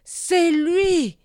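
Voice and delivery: high, loud cry from under a mask; shaman repeats his hateful cry behind the mask; muffled accusation. Acted. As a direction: hurl the accusation through the mask. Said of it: "répéta-t-il".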